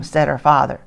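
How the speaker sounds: ordinary delivery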